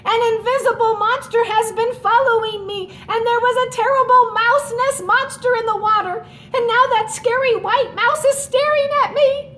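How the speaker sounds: high, loud rushing wail. Acted. Little Mouse wailing his fears to Big Mouse. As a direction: pour it all out in a wail. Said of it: "he wailed".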